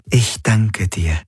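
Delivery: langsam und dankbar, fremde Stimme; glücklich. Acted. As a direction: ein leises, glückliches Danke. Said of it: "Die Helix klang glücklich". (langsam, glücklicher Dank)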